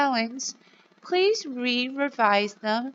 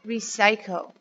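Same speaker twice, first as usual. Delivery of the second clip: normal talking voice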